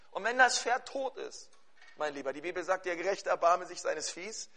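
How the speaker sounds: normal talking voice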